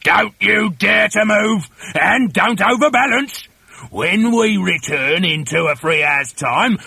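high, shouted trainer's commands; threatening orders. Acted. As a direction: lay down the law, shouting menacingly. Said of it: "Mister Twit ordered".

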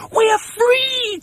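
very high little-one squeal; joyful child's cry. Acted. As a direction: squeal it like two overjoyed youngsters. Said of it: "cried the two little ones".

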